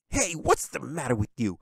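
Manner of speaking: put-on boy yelling in shock at a hug; sharp surprised yell; startled shout. A narrator's reading. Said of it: "he shouted".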